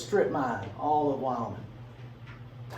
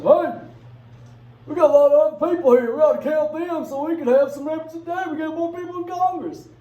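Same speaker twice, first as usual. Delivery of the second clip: much higher and louder, pushy and excited; eager delegates; 13 semitones higher, 9 dB louder than usual. Retold or imitated. Southern delegates demanding extra representation, high and loud. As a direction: shout it like greedy delegates spotting an advantage. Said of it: "Some people looked around and said".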